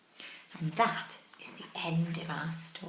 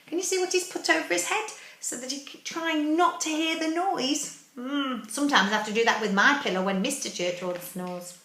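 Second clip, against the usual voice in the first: ordinary delivery, nothing marked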